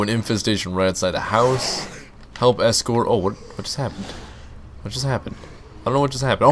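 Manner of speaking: ordinary talk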